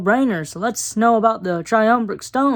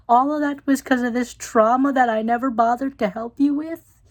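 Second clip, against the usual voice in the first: mock dismayed mom; 5 semitones higher than usual. Barb's dismayed realization quote.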